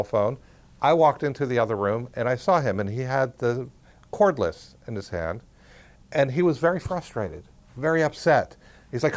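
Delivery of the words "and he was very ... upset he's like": usual speaking voice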